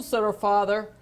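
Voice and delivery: plain reading voice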